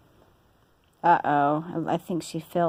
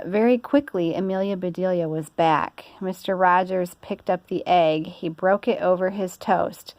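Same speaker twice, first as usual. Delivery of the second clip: plain reading voice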